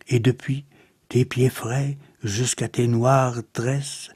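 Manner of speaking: measured, subdued poem reading with pauses; solemn, restrained verse reading; poetry recitation. Acted. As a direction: recite verse softly and evenly, letting each line settle.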